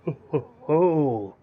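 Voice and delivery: slow, hearty ho ho ho; a lightly voiced, jolly lord's laugh; jovial laugh. The arriving noble greeting the player with a jolly laugh.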